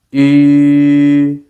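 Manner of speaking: "E" said as E with lips rounded into ü; "E" rounded like saying ooh; Mandarin ü vowel. Shown as a demonstration. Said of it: "One more time".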